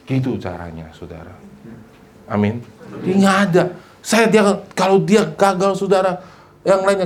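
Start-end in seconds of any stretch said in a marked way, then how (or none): none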